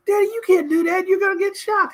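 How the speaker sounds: put-on high, fast worried kid begging his dad to stop; panicky child. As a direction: play the scared little boy pleading, high and quick.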